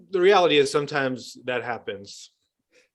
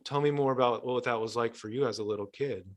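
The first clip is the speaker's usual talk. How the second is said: tender compassion; 6 dB quieter than usual. Acted. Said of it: "And I'll say".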